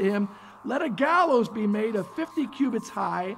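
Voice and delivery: reading the verses aloud, measured; scripture reading. Acted. Preacher reads the verses of Esther aloud, measured. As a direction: read the scripture to the congregation.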